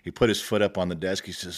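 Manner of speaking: ordinary delivery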